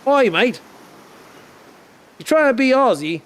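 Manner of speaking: high-pitched mock Australian accent; Aussie impression. Streamer teasing about being Aussie, high mock Australian accent. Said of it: "hope you liked my, uh, impression".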